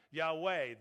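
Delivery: usual speaking voice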